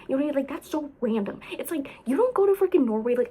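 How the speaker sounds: ordinary talk, nothing marked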